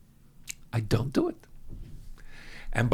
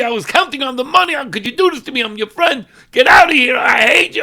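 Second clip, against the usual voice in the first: high, loud, betrayed yelling; angry outburst rising to a cry; furious shout; 10 semitones higher, 10 dB louder, faster than usual. Retold or imitated. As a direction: scream at the friend who cheated.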